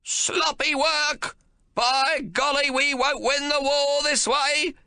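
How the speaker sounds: put-on high, loud old man bellowing, slow; cranky old man shouting. A narrator's reading. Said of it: "he shouts down at their backs".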